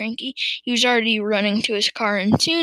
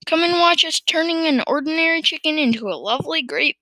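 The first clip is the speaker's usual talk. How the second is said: higher-pitched eager boast; excited calling; 6 semitones higher, 3 dB louder than usual. A narrator's reading. Farmer inviting his wife to watch, calling excitedly. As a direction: show off the experiment proudly. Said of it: "he called out to Missus Cranky".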